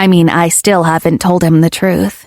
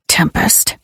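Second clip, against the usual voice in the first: a low, half-whispered growl of her name; low and growly; 2 semitones lower, 4 dB quieter than usual. Acted. Lover warns her she's in trouble: low growl. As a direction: warn her she is in trouble with one growled word. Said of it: "all low and growly".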